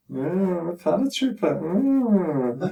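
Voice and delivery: high, drawn-out swoon; mock swooning woman eyeing a paratrooper; flirty admiring voice. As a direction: purr it like you're impressed.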